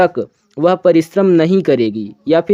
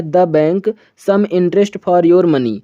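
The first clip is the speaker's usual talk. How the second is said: slow dictation; much slower than usual. Shown as a demonstration. Teacher reading blank sentence slowly.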